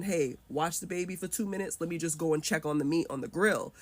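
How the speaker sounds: usual speaking voice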